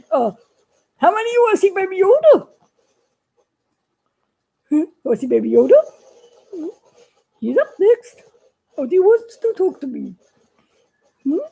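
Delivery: an eager puppet announcing the next act. Acted.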